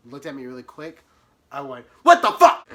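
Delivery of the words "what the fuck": very loud, high shout; shocked yell. Retold or imitated. Yelling in the courtroom, loud and high. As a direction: blurt it out at full volume.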